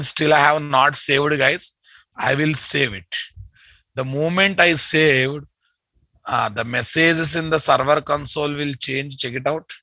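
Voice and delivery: ordinary delivery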